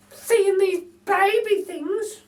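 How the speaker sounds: very high, slow, chirpy lady voice; a cheeky cockney burglar lady; Burglar Betty. A narrator's reading. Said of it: "she says".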